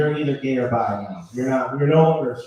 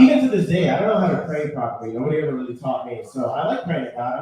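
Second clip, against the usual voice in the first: normal talking voice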